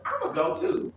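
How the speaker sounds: high-pitched, chirpy butting in; mimicking a friend inviting herself; imitated eager friend. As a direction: pipe up way above your own voice.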